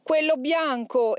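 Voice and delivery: high, long-drawn demonic cries; demon exclaiming. A narrator's reading. Demon seeing saints kneel, high anguished exclamation. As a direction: wail in protest as a demon. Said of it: "il demonio esclamò".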